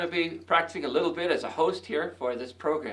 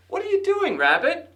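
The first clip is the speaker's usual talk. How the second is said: high call across the meadow as Mouse; 12 semitones higher, 4 dB louder than usual. Acted. Mouse calls high to Rabbit in the meadow. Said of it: "Mouse called".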